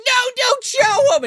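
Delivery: very high, loud, slow shrieked plea; hammed-up shriek; mom's shout. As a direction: scream it out as the desperate mom. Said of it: "and she's like".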